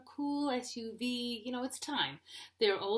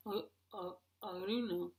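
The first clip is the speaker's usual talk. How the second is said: defensive husband impression; mock husband voice; 4 dB quieter than usual. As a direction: plead ignorance like a dopey husband. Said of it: "That's my husband".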